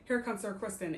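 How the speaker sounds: normal talking voice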